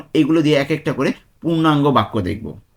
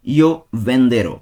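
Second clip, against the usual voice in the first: slow, drawn-out Io venderò, every syllable separate; slow demonstration; 4 dB louder, much slower than usual. Shown as a demonstration. Teacher showing students the future tense, very slow. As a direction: stretch Io venderò out slowly so learners catch it. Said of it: "first take of the demo".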